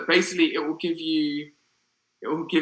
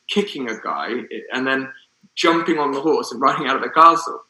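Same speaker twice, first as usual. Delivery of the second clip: ordinary delivery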